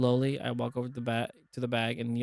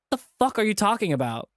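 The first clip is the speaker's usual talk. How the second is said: higher, louder furious demand; angry outburst; 8 semitones higher, 6 dB louder than usual. Acted. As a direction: spit it at him in rage. Chase furious, grabbing TJ.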